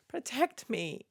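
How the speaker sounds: hushed, high mock plea; whispered plea. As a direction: breathe it like a prayer. Repeated mock prayer, whispered.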